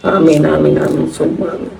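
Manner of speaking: low, softening amens fading out; murmured prayer amen. Real, not acted. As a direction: close the prayer gently, trailing off. Closing a prayer for the dead.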